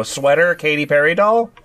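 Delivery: ordinary delivery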